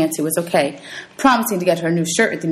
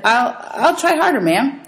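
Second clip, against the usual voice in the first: nervous stammer; 7 semitones higher, slower than usual. Acted. Scared boy stammers to teacher.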